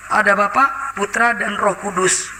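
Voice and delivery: usual speaking voice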